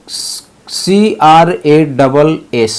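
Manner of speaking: letters spelled out one by one, very slow; deliberate letter-by-letter spelling. Shown as a demonstration. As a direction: spell each letter slowly for the class. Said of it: "spelling out letters slowly".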